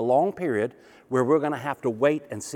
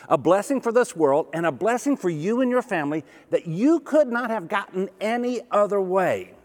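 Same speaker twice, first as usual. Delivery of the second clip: rising preaching fervor; 6 semitones higher than usual. Real, not acted. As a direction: lift it to a proclamation. Preaching climax builds.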